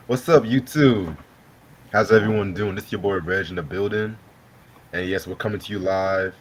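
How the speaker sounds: upbeat livestream host greeting; show host intro. Acted.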